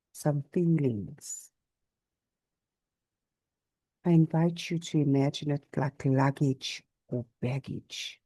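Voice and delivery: unhurried yoga nidra voice with long pauses; guided meditation. Real, not acted. Teacher guiding listeners to notice body and breath, calm meditation. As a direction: guide them gently through the body, leaving space after each phrase.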